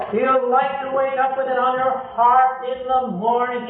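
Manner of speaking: usual speaking voice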